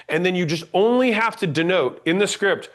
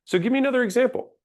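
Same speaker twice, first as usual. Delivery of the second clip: fast, higher, a firm command with no question; 7 semitones higher, much faster than usual. Shown as a demonstration. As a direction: order it briskly, fast. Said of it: "that wasn't a question. That was a command".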